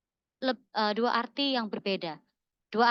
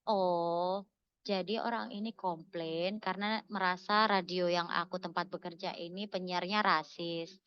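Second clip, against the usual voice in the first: lower, softer, measured realisation; calmed down; 5 semitones lower, 6 dB quieter, slower than usual. Acted. Rereading the complaint after cooling off, calm and low. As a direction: think it through quietly, with a cool head. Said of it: "Kalau udah cooling down, udah tenang".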